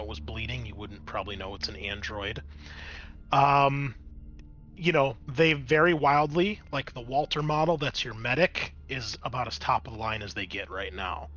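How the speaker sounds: ordinary delivery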